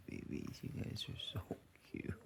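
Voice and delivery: hushed cooing over the dogs; soft whisper. Real, not acted. Owner whispering to sleeping puppies, hushed and fond. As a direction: whisper it with a little laugh.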